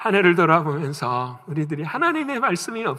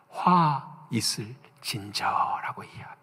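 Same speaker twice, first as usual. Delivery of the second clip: slow, breathy whispered warning; solemn whisper; 4 semitones lower, 5 dB quieter, slower than usual. Retold or imitated. Lord's woe quoted to congregation, slow whisper. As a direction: let the woe fall slow and hushed.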